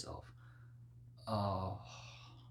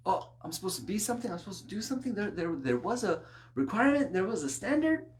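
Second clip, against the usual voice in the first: mock disbelief; 8 semitones higher, 4 dB louder, faster than usual. Mock surprised sinner.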